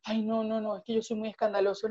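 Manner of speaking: hushed, embarrassed and quick; shy mumble. Retold or imitated. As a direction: shrink into the words. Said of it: "tú te cohibes y dices".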